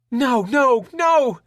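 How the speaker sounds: shrill terrified screaming; panicked scream. Acted. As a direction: scream it through tears. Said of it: "Pam continued to scream".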